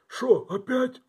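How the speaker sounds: high, loud put-on cartoon wolf voice; mock the wolf's weary complaint; cartoon wolf quote. Quoting the wolf from the cartoon, cartoon wolf voice. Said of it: "как в том мультике про пса и волка".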